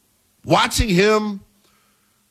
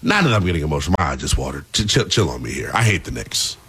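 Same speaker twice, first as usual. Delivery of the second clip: mock emotional; 11 semitones lower, faster than usual. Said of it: "am I getting emotional here".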